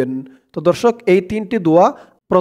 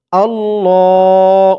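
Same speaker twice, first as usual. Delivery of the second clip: drawn-out, louder chanted Allah; slow recitation; 3 dB louder, much slower than usual. Shown as a demonstration. Teacher demonstrates the word for learners, slow chanted recitation. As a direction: stretch and intone the word. Recites syllable after spelling it out.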